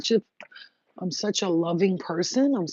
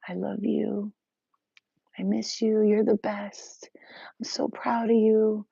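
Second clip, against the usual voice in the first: dreamy whisper; 4 semitones higher, slower than usual. Pretend. Dream visitor whispering love to her. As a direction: breathe it like a loving spirit.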